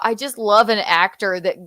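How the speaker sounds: ordinary delivery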